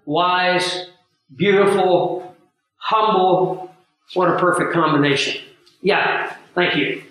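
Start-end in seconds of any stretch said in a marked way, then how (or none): none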